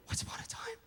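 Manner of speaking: breathy hushed storyteller whisper; whispered wonder. Shown as a demonstration. Storyteller hushing a child, Once upon a time whispered. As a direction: whisper it like a magical secret.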